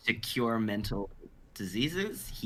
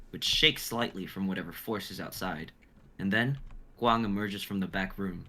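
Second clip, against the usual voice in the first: normal talking voice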